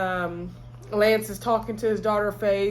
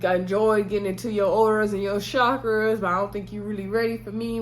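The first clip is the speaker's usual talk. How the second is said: normal talking voice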